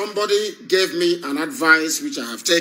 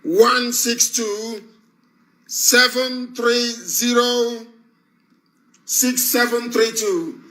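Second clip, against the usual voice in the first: slow dictation; 5 semitones higher, much slower than usual. Real, not acted. Dictating a phone number slowly.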